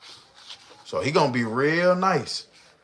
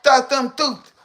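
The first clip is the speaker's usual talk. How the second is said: imitating her; 10 semitones higher than usual. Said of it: "could you picture her saying that".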